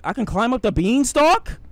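high, loud incredulous question; loud disbelief. Real, not acted. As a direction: blurt it out, astonished at the discovery. Shocked discovery in game.

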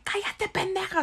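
high-pitched mock yell, held back; imitated angry yell. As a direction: mimic an attacker ordering silence. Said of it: "me empezó a gritar horrible".

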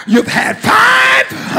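high, slow, drawn-out; emphatic. Acted. Jesus confronting the woman, slow high emphasis. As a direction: stretch it out to make the point. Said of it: "Jesus says".

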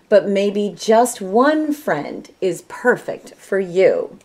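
plain reading voice

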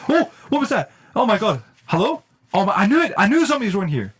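loud, high alarmed reaction; startled. Real, not acted. Player realizes somebody is around here, loud and startled. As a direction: voice leaps up in sudden alarm. Hears someone nearby in game.

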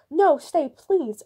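loud, high plea; cried out. A narrator's reading. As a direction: call out to stop her leaving. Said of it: "Ursula cried".

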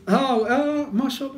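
high "ah, ah" of sudden recognition; delighted surprise. Retold or imitated. Preacher recognising the former homeless man, high and delighted. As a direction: let the penny drop joyfully.

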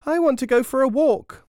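put-on high, loud child's demand; clamouring child. A narrator's reading. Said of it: "Yet another".

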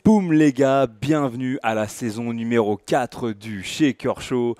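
loud, drawn-out show opener; booming, slow-rolling welcome to listeners; podcast host intro. Acted. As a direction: kick off the show big, stretching the words.

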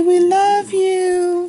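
high, slow and drawn out; slow sing-song prompt. Shown as a demonstration. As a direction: sing it slowly for her to copy.

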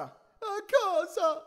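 very high, drawn-out mock shriek; shrieking back. A narrator's reading. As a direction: squeal the question back in panic. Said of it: "strillò lui di rimando".

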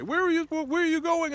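high, loud put-on shouting over the crowd; hammed-up yell of a pushy bus hawker; mock yelling bus touts. Traveler mimics screaming bus staff, mock high yell. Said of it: "they start screaming at each other".

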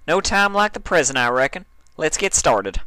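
bright friendly country goodbye; country mare. Acted. Applejack saying goodbye to Dash, high and cheery.

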